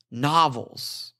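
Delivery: normal talking voice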